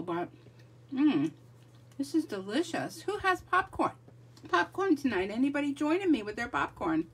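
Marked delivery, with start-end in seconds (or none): none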